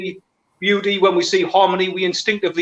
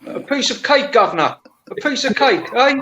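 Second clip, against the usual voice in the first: lightly put-on Cockney accent, higher; a hint of a London cabbie offering cake; mock Cockney; 5 semitones higher than usual. Cockney accent, friends joking about street dawah talk. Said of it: "is that Geordie accent".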